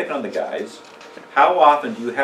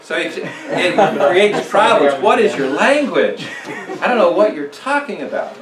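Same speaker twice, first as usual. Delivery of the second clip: laughing; 8 semitones higher, 7 dB louder than usual. Real, not acted. Still laughing after crow joke.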